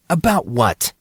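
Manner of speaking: a touch defensive, guarded question. A narrator's reading. Monson to Grayson, mildly defensive. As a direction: push back lightly, on guard. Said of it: "Monson answered defensively".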